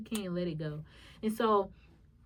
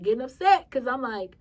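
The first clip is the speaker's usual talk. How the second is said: ordinary talk, nothing marked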